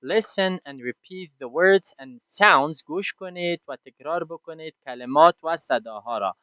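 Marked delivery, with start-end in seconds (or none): 0.0-2.8 slow, loud, each word spaced out; slow instruction. Shown as a demonstration. Teacher reading the book's instruction to the class. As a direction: read the instruction slowly and clearly. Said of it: "In here it says".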